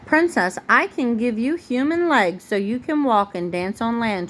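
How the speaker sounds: usual speaking voice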